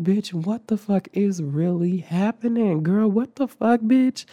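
very high, drawn-out squeal of disbelief; freaked-out disbelief. Retold or imitated. Student watching teacher rush to the fire, freaked-out disbelief. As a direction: squeal it, acting out total bewilderment. Acted panicked reaction to the fire.

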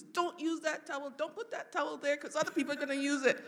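high-pitched, bossy fussing; imitated nagging. Mom instructing daughter during her bath, mock nagging. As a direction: rattle off orders like a fussy mother. Voicing mother's bathing instructions.

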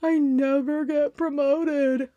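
slow, sulky mock complaint; mock self-pity. Mocking a slacker who moans about promotions, slow. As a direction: drag it out like a sulking loser. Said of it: "that person that's gonna sit back and be like".

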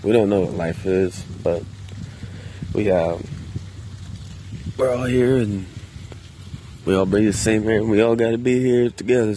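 ordinary delivery

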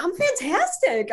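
high, drawn-out gushing reply; elated amazement. Retold or imitated. Client answering healer's are you okay, elated. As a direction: retell being blown away.

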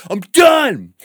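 high, loud jock yell; mock Gronkowski yell; football star shout. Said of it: "from being flamboyant ... Rob Gronkowski".

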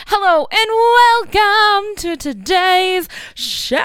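high, slow, drawn-out talk-show host welcome; hammed-up show host. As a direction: play the over-the-top TV presenter with the mic in hand. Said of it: "this pink microphone in my hand is really getting me jazzed up".

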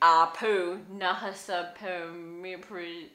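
slow, halting attempt at a name; careful sounding-out. Real, not acted. Co-host attempting a difficult character name, slowly and haltingly.

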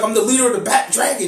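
hammed-up cocky boast. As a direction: announce yourself with mock swagger. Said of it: "He starts going in".

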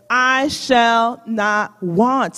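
each word of "I shall not want." drawn out slowly; slow, weighty scripture reading; slow recitation. Shown as a demonstration. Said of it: "Say it with me".

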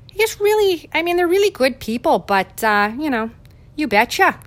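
mock the Wisconsin relatives' folksy Minnesota-style accent. Teasing her far-north Wisconsin family, in a mock Wisconsin accent. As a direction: pitch jumps way up in a hammed-up northern Wisconsin accent. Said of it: "that's how they talk".

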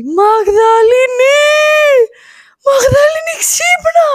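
high, drawn-out mock holler; imitated shouting. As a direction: re-enact yelling up to wake someone, stretching the name. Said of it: "Και αρχίζει και φωνάζει".

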